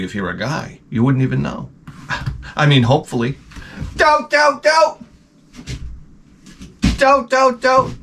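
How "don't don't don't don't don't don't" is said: high, squealing protest; mock shriek. Comic pleading to the crowd not to react, high squeal. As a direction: shriek as if begging someone to stop.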